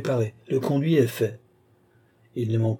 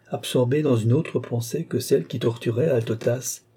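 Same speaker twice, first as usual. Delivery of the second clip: ordinary delivery, nothing marked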